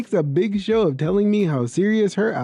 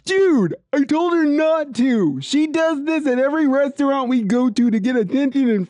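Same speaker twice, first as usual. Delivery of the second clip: high-pitched outburst; exasperated boyfriend; 7 semitones higher than usual. Acted. Boyfriend finally speaking up about his girlfriend, exasperated. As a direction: burst out in fed-up protest.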